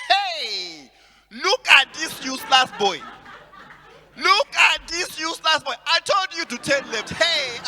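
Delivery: put-on loud scolding in a Nigerian accent; angry Nigerian accent. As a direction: scold the driver like a Nigerian auntie. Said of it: "Nigerian accent there".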